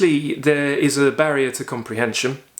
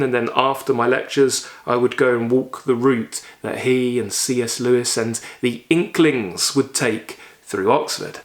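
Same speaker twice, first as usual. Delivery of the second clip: normal talking voice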